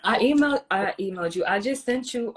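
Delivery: ordinary talk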